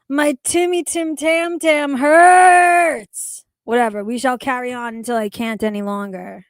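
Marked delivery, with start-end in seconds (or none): 0.1-3.5 slow, drawn-out loud whine; pained shout. Real, not acted. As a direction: holler it slowly, like the belly really aches. Complaining tummy hurts from screaming.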